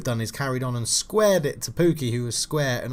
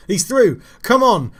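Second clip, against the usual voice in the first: loud, high "come on" at the striker; urging shout; 9 semitones higher, 8 dB louder than usual. Real, not acted. Gamer urging his striker through on goal, shouting. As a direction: yell him on toward goal.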